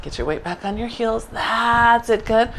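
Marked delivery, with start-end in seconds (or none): 0.0-1.2 the same cue said again much softer; gentle quiet reminder to the class; softer repeat. Shown as a demonstration. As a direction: drop the volume and repeat the reminder. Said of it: "Still get your weight back on your heels".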